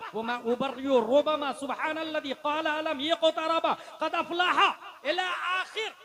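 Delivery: loud Arabic recitation climbing in pitch; forceful recitation. Acted. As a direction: recite in a driving run, building in force.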